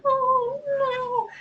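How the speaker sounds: mock alarm.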